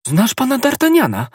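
high, loud startled cry; cried out. Acted. The novice hearing D'Artagnan's name, crying out. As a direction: shout the name in shock. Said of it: "krzyknęła nowicjuszka".

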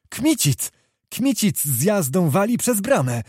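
high-pitched exclamation of a name; urgent lookout's cry; excited sighting. A narrator's reading. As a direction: call out the charge, pitch leaping up. Lookout spots Kmicic charging.

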